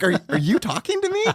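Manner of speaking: high-pitched, laughing disbelief; mock incredulity. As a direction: ask it as if baffled, giggling. Retelling reply to whispering stranger.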